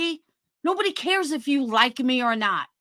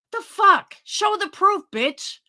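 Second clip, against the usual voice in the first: higher, louder snapping challenge; angry demand; 7 semitones higher, 3 dB louder, slower than usual. Real, not acted. Streamer challenging rival to show proof, angry. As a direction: fire it back at her.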